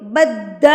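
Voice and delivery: بد with the dal pressed hard and held; doubled dal. Shown as a demonstration. Teacher stressing the shadda, بد with a pressed dal. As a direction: lean on the doubled d in بد. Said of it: "دال پہ تشدید ہے تو زور دیں".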